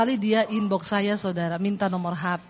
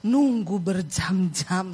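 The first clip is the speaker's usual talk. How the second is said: ordinary talk, nothing marked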